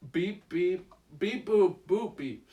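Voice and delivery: wrong beeps; beeping like a little robot; toy robot voice. Shown as a demonstration. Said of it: "Sorry, I, I said beep, beep. That's not right".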